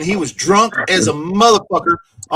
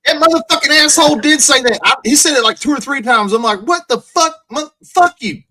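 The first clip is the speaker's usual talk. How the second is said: higher, louder angry outburst; heated rant; 9 semitones higher, 4 dB louder than usual. Real, not acted. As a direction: vent at him, fast and loud. Angry rant about the troll.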